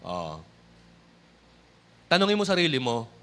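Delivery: ordinary talk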